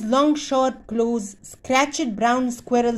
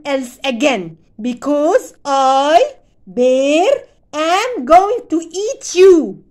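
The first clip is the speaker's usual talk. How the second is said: slow, louder menacing bear threat; growled; 4 semitones higher, 7 dB louder, slower than usual. Acted. Bear threatening the pinned squirrel, slow menacing growl. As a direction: lean in and growl it slowly. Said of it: "Bear growled".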